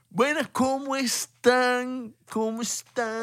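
high, drawn-out mock greeting; hammed-up YouTuber intro, stretched way out; old-school YouTuber. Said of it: "como el típico youtuber de hace cuatro años". (saludo exagerado de youtuber antiguo, voz aguda)

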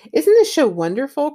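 a touch of breathless delight; excited cry. A narrator's reading. Mildred gushing over the wall show, mildly excited. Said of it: "cried Mildred".

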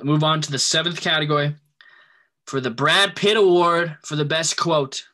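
loud, slow announcer voice; loud announcing. Real, not acted. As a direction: announce the category loudly and slowly. Announcing award category.

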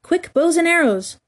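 high, eager order; urgent call. A narrator's reading. Simple boys rush to obey Peter, urgent call.

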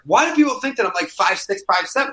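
incredulous. Retold or imitated. Retold tweet, incredulous.

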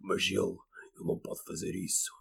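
slow, low, disguised TV voice; disguised deep voice. Retold or imitated. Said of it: "Metem, metem vozes assim".

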